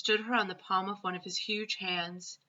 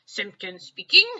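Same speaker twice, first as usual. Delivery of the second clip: louder, self-important phone greeting; important telephone voice; 11 dB louder than usual. A narrator's reading. Father answering the phone in his very important voice. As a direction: answer like a man who matters. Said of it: "in his very important telephone voice".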